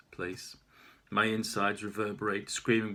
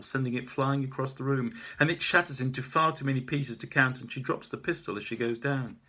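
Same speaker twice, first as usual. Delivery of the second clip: ordinary delivery, nothing marked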